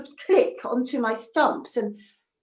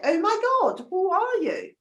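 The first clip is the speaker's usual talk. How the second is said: astonished; 7 semitones higher than usual. Acted. Man discovers girl in orchard.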